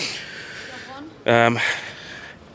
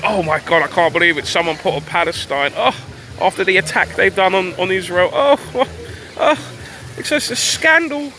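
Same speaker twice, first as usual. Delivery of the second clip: high-pitched mock scandalized reaction; mock shock; 8 semitones higher than usual. Mocking the papers' reaction to the flag, mock shock. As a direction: overdo the outrage, pitched right up.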